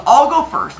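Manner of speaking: loud, high burst; eager Zeus. Retold or imitated. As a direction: jump in keenly, acting it out. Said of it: "He goes".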